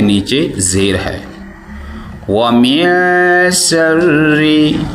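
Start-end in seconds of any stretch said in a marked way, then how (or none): 2.3-4.8 slow melodic tajweed chant; quranic recitation. Shown as a demonstration. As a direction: recite slowly, drawing out each vowel. (धीमी सुरीली क़िरात)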